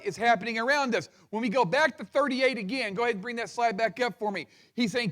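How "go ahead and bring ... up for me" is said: ordinary delivery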